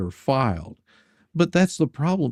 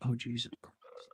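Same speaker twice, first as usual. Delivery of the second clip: exasperated mutter; 14 dB quieter than usual. Retold or imitated. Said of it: "And it's just like".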